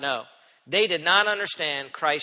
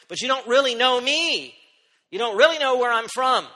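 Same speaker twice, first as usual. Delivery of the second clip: higher, louder, leaning on "really"; Jesus's rebuke; 7 semitones higher, 6 dB louder than usual. Acted. Jesus telling the crowd they don't know him, emphatic. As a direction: press "really" with firm intent.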